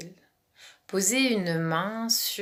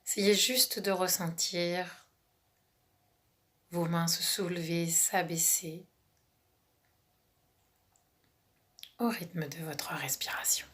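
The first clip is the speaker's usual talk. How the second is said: calm relaxation guide, half whispered; guided meditation. Acted.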